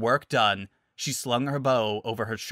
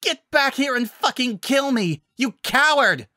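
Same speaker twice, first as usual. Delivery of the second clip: furious shouting; 13 semitones higher, 6 dB louder than usual. Acted. Villain shouting after hero.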